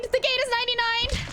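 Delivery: shrieking jackpot winner. Real, not acted. Urgent callout during escape.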